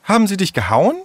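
high-pitched, indignant question. Acted. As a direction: ask it outraged on a friend's behalf. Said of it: "fragte Tessa empört".

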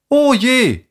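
high-pitched, sighed dismay; sighing. Acted. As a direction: sigh out your concern. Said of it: "seufzte Jule".

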